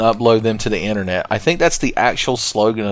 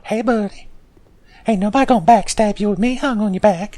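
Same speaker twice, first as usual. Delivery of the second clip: high-pitched ghost sidekick voice; Gower; 10 semitones higher than usual. Acted. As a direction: pitch it way up as an overfriendly neck-hugging ghost. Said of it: "spooky ghost man hanging around our neck".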